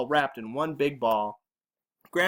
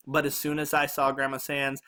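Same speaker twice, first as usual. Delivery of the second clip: usual speaking voice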